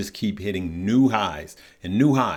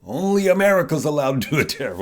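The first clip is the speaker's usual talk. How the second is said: high-pitched, hammed-up indignation; mock upset president; 5 semitones higher, faster than usual. Host jokingly voices the president objecting to Canada's tariff, mock upset. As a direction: play an offended leader, voice lifted in mock outrage. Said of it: "the president got upset and says".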